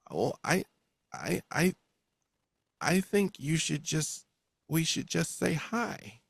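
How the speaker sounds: high, halting, hesitant voice; timid stammerer. Acted. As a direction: stammer out a tentative suggestion.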